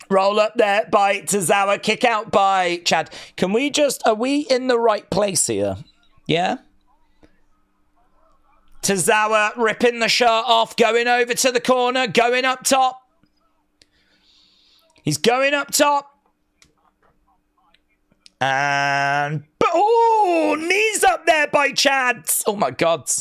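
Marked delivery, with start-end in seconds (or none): 0.1-3.1 lively play-by-play call; wrestling commentator. Acted. Calls the match play-by-play. 8.8-22.3 excited wrestling commentator. Acted. Calls the match play-by-play.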